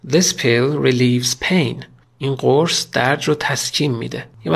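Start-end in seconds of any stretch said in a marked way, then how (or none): 0.1-1.8 very slow, word by word example reading; slow model sentence. Shown as a demonstration. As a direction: read This pill relieves pain slowly for learners. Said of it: "مثلاً".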